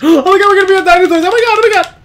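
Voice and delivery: high, fast gushing after a gasp; excited outburst. Real, not acted. Hearing the meeting is by the dinosaurs, wildly excited. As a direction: squeal it out in a breathless rush. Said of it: "Sorry, I get a little excited".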